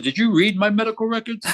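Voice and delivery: incredulous and irate, voice shooting up; going off on her. Retold or imitated. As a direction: retell an outraged challenge. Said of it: "I went off on her".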